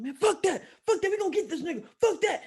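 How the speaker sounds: high, loud, worked-up imitation; upset homeboy. Storyteller imitates homeboy wanting revenge, upset. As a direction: rant as the fired-up friend. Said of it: "he's, like, upset and running".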